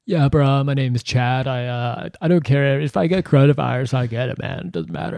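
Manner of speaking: lightly voiced careless party bro; dumb bro voice. Mocking Florida spring breakers, in a light dumb-bro voice. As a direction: toss it off like a clueless frat guy.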